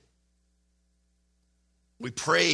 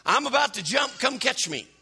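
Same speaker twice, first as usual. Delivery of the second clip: eager pretend shout, pitched up; imagined call to Jesus; 8 semitones higher than usual. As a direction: call out as if about to jump. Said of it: "stand up on the edge of the boat and say".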